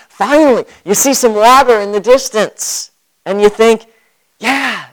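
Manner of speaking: high, eager voice of a thirsty man finding water; excited relief. Pretend. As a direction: play the parched father who spots water, all hope. Imagined thirsty traveller's relief.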